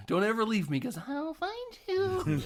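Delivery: high, sing-song mock threat; clingy crazed lover. Girlfriend warning her new man, high creepy-sweet voice. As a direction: stretch it into a creepy high lilt.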